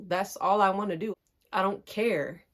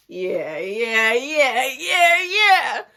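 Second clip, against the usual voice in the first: mock yeahs, building; 3 semitones higher, 6 dB louder, much slower than usual. Answering the cousin's call back, mock yeahs building. Impatient mimicry, building with each repeat.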